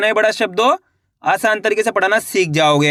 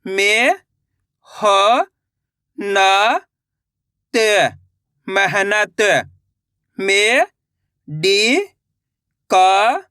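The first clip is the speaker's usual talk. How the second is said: slow, separate syllables, then the word drawn out; patient teacher dictating syllables; syllable by syllable reading; much slower than usual. Shown as a demonstration. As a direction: spell each sound for beginners, then blend.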